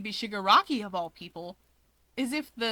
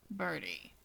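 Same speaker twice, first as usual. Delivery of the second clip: much lower and softer, clipped warning; cold cut-off; 5 semitones lower, 10 dB quieter than usual. Acted. Dabi to Keigo, cutting him off coldly. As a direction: shut him down in one word.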